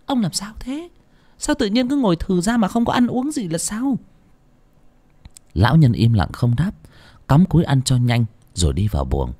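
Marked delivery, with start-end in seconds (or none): 0.1-4.0 much higher, anxious wife voice; worried wife. Acted. Wife asking why her husband isn't eating, worried. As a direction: fret over him like a puzzled wife.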